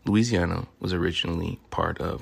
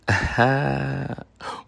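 laugh; 7 semitones higher, 3 dB louder than usual. Real, not acted. Laugh after intro.

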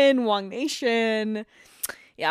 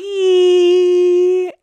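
high squeal; 10 semitones higher, 14 dB louder than usual. Acted. Guest answers in high cry.

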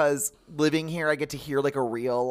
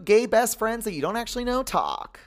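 mock announcer; 11 semitones higher, 4 dB louder than usual. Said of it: "I'll be like".

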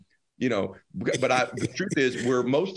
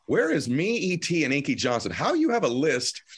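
mock outrage; 7 semitones higher than usual. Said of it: "I'm like".

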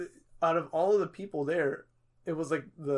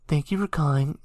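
louder, close to the mic; close-mic host voice; 6 dB louder than usual. Retold or imitated. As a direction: imitate the host hugging the mic. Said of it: "me talking so close to my mic".

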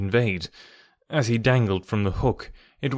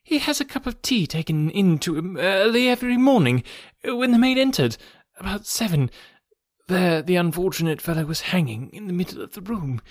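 high, trembling doctor's voice; a frightened physician breaking bad news; shaken doctor; 6 semitones higher than usual. Doctor recounting the hanging.

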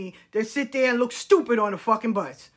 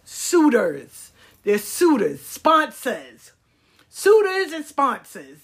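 mocking emphasis; 3 semitones higher, 6 dB louder, slower than usual. Real, not acted. Mocking the woman's terms.

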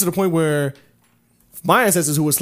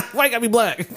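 laughing protest; 7 semitones higher than usual. Real, not acted. Laughing protest at Darnell joke.

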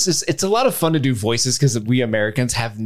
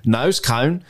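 NOSE CONE with broad Australian vowels; Australian accent. Shown as a demonstration. Host bringing back the trick phrase, Australian accent. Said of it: "play in the wheelhouse that is".

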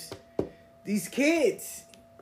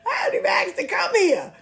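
mock protest; 13 semitones higher, 6 dB louder than usual. Retold retort to fishing group.

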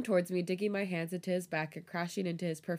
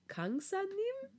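"Kangsa-nim" slow, soaring high at the end; questioning rise; 14 semitones higher, 5 dB quieter, much slower than usual. A narrator's reading. Student apologising to her teasing teacher, "Kangsa-nim" rising like a question. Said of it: "Are you asking me?".

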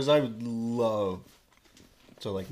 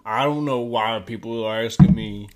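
slow, loud drawling O J voice; slow and loud, imitating O J; O J impression; 7 dB louder than usual. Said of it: "And he's like".

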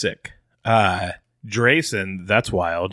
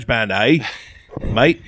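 mock Australian. Teasing Australian accent.

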